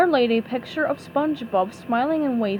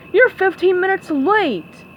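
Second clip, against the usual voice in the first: slow, higher, louder scolding; angry boss yell; 7 semitones higher, 6 dB louder than usual. A narrator's reading. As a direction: bark at the late worker. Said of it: "yelled Mister Krabs".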